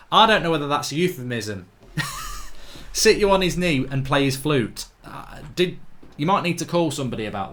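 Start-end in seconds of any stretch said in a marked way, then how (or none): 1.9-2.9 quick unvoiced laugh; soft snorting chuckle; breathy laugh. Real, not acted. Laughs at the euphemism joke.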